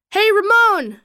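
calling out. A narrator's reading. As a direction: hail someone across the yard, restrained. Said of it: "Mitchell called".

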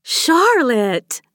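high, loud drawn-out name; calling out. A narrator's reading. Maeve grabs her friend's arm, calling out. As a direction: call the name insistently, stretching it.

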